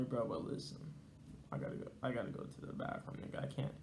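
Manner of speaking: hushed, half-whispered plea to a buddy; hushed aside. Retold or imitated. Narrator confiding urgently in his friend, hushed. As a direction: drop to a near-whisper.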